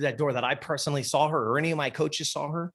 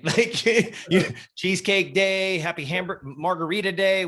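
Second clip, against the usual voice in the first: laughing; 5 semitones higher than usual. Real, not acted. Joking mock guess.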